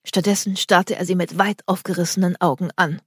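ordinary delivery, nothing marked

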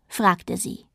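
usual speaking voice

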